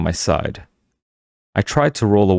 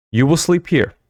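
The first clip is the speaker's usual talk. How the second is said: stern; 8 semitones higher than usual. A narrator's reading. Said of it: "she said sternly".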